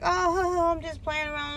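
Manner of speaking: high, breezy "oh, I'm just playing around", trailing off soft; mock innocent relative. Relative dismissing the bridge scare, high mock innocence. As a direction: lightly imitate the relative shrugging it off.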